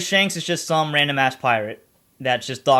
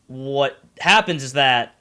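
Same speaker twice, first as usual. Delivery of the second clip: ordinary talk, nothing marked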